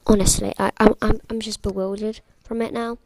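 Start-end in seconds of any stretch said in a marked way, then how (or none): none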